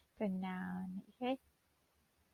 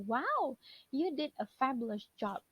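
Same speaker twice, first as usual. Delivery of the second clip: impressed praise; 2 semitones higher than usual. Shown as a demonstration. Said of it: "kalau kita tambahkan wow".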